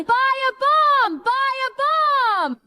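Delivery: high, slow drawn-out street-seller call; hawker's shout. Acted. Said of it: "They could only keep shouting".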